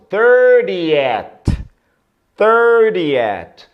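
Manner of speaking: thirtieth said slowly and clearly, high and loud; teacher models thirtieth for students, clear and careful; model pronunciation. Shown as a demonstration. Teacher drilling students, careful model word. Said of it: "Então, trigésimo".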